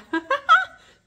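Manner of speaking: high, loud squealing laugh; delighted cackle; real giddy laugh. Real, not acted. Laughing mid-title.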